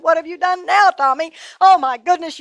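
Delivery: high, loud worried scolding; mother finding her son bleeding; alarmed mother. A narrator's reading. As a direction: rush in alarmed at the blood. Mother finds bleeding child.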